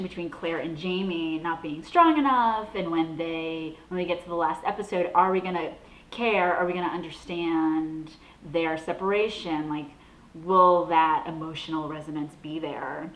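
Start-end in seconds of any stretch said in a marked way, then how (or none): none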